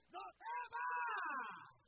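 very high, very loud, long stretched shout; drawn-out cry. Acted. Preacher crying out to the crowd not to fear, soaring drawn-out shout. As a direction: hold the last word as a soaring cry. Climax of the exhortation.

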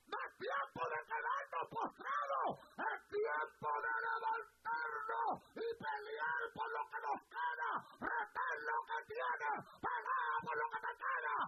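high, loud, near-crying preaching cry; shouting preaching. Acted. Preacher shouting at the church to fight for what remains.

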